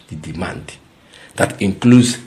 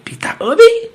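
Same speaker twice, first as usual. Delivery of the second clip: incredulous; 9 dB louder than usual. Real, not acted. Incredulous name.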